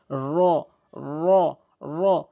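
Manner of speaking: "র" with tongue tip curled up to the palate; full rounded R. Shown as a demonstration. Teacher showing learners a Quran letter, full rounded "র". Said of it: "গোলাকার করে পুর করে".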